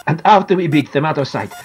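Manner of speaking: put-on Italian star bargaining with a sick boy; Italian accent. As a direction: kindly persuade with an Italian accent. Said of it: "I recognise his Italian accent".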